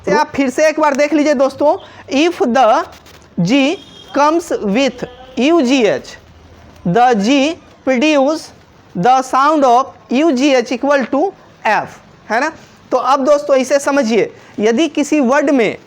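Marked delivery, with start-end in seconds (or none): 2.1-12.0 slow dictation. Shown as a demonstration. Teacher dictating the rule slowly for students to note. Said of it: "slow rule reading".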